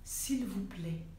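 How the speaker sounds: very slow, soft, whispery entreaty; earnest, drawn-out near whisper; hushed plea. Real, not acted. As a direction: plead gently, drawing out each word. Pleading with her audience.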